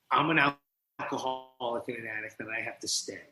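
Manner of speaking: soft, low confession; quiet confession. Retold or imitated. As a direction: admit it quietly and heavily. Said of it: "I go".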